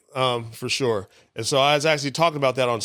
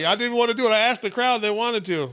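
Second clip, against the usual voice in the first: higher, pleading excuse; whiny protest; 10 semitones higher than usual. Retold or imitated. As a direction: plead your innocence up high. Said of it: "I was like".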